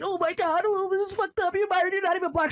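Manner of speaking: mock whining critic. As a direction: parody the crybabies complaining.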